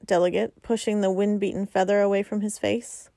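ordinary delivery, nothing marked

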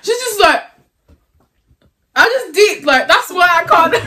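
high, loud giggling talk; laughing. Real, not acted. Laughing at elbow in face.

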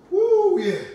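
loud high woo; excited whoop. Real, not acted. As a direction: whoop to fire them up. Trainer cheering during cardio.

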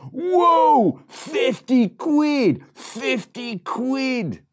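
high, loud, slow amazed squeal; excited. Acted. Piglet thrilled by his fifty quid, high squeal. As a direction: gasp at the money, slow. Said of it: "he was so excited".

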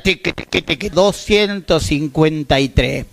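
slow mechanical ticking of a scale, then the weight read out; imitating the shop scale. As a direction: click it out like an old scale, slowly. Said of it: "y la balanza decía".

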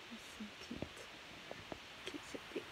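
soft unvoiced whisper of adoration; whispered gush. Real, not acted. Girl whispering over her late dog's photo.

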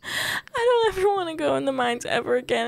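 high teary rush; relieved sob talk; crying. Real, not acted. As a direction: cry it out fast.

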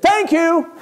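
high, loud mock-grudging thanks; exasperated thanks. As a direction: imitate a fed-up mom forcing out thanks. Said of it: "That's how she said it".

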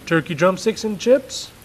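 a hint of a flustered dad; lightly voiced, unsure father.

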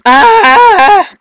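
long, loud, high shriek; high scream. Real, not acted. Player failing in the game, high long scream. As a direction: let out a sustained shriek.

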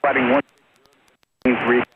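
choppy radio cutting out; broken-up radio transmission; breaking up. Real, not acted. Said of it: "Sorry, you're breaking up".

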